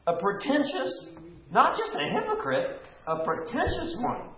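"pretentious" hit high both times. Real, not acted. Teacher insisting to the group that "pretentious" is the key word, pitched high. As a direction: lean hard on "pretentious", voice up high. Stressing the Greek meaning.

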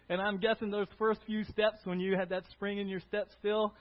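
normal talking voice

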